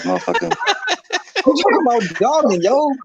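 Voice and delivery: high, laughing mock rant; imitating a rant through laughter. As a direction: rant it high while cracking up.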